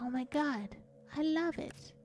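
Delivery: put-on high, soft, delighted child voice; small child. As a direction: softly gush like an awed little kid. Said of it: "as a small child, was like".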